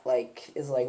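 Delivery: ordinary talk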